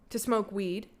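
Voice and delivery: normal talking voice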